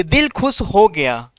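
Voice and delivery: slow, savouring delight; slow and relishing, as if hearing a great joke; delighted exclamation. Shown as a demonstration. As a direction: drawl it out like a pleased listener. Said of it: "बोलते हैं ना".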